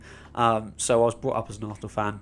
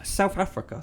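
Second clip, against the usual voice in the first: surprised; 8 semitones higher than usual. Real, not acted. Amazed at a viewer from South Africa.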